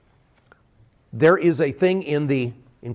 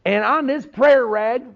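put-on TV preacher accent; 7 semitones higher than usual. Pastor mocking a TV evangelist selling prayer rags, hammed-up evangelist accent. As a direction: lay on the televangelist accent, selling hard. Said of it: "they all talk in that type of accent".

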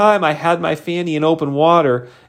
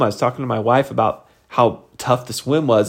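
usual speaking voice

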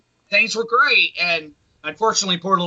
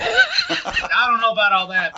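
higher, laughing through words; 5 semitones higher than usual. Real, not acted. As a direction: laugh while protesting.

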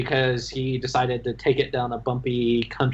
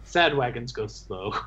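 Jayag imitation; 3 semitones lower, 3 dB quieter than usual. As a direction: lightly attempt the character's voice. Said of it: "I don't have his voice, but".

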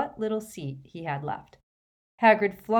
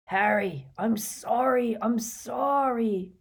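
slow, higher sorry moan; 5 semitones higher, slower than usual. Acted. Hagrid apologising to Harry, slow mournful moan. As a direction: drag out the apology in misery. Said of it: "moaned Hagrid".

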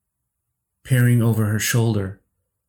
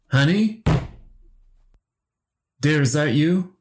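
high, questioning call to a wife; 6 semitones higher than usual. A narrator's reading. Husband calls out to his wife amid pounding, calling out. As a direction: call out for someone, lifting high at the end. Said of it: "I called out to my wife".

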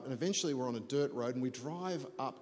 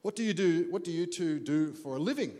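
put-on louder, lightly accented Hungarian host; 5 semitones higher, 6 dB louder than usual. Winery owner asks couple about their work, light Hungarian accent. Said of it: "in his Hungarian ac-, accent, he says".

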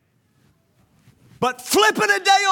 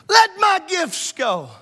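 slow, ringing preacher call; resonant slow sermon; 4 semitones higher, slower than usual. Shown as a demonstration. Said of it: "in your best preacher voice".

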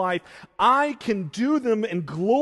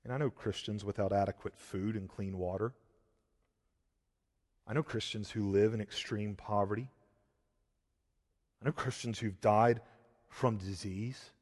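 soft, lower, quick sober voice; hushed preaching; 10 semitones lower, 11 dB quieter than usual. Acted. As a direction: drop to a hushed, sober testimony. Quiet reflective preaching passage.

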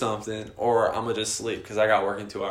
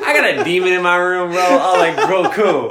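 loud, high outburst through laughter; comic exasperation; 6 semitones higher, 9 dB louder than usual. Retold or imitated. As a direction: exclaim it as mock-delighted panic, laughing. Sarcastic panic about demon, retold.

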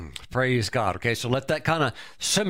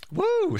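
excited whoop. Real, not acted. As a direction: let out a sudden preacher's whoop. Preacher whoops after punchline.

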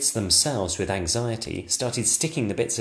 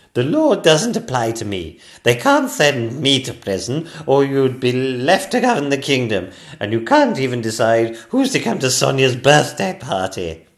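haughty queen; 4 semitones higher, 8 dB louder than usual. Acted. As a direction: put the king in his place. Queen retorting.

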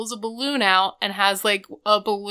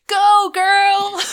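high, loud cheering yell; crowd yell; 12 semitones higher, 10 dB louder than usual. Retold or imitated. Fan cheering from the crowd, loud high yell. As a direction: shout it from the back of the crowd.

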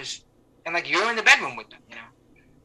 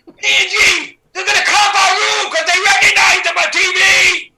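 high, loud, slow excited scream; dad screaming; 9 dB louder, slower than usual. Retold or imitated. Deaf dad screams across the casino to his wife, loud and high. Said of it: "So he screams".

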